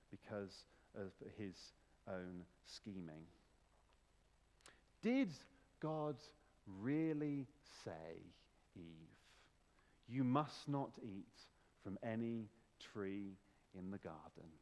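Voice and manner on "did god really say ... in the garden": insinuating serpent, long pauses, fading low; Satan questioning Eve. Acted. Preacher acts the serpent questioning Eve.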